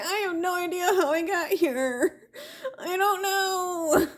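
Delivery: high, laughing and helpless; laughing confusion. Retold or imitated. She answers the alien, high helpless laughing. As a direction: giggle out the bafflement, the end slowed. Retelling her confused reply.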